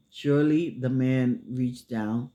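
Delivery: ordinary delivery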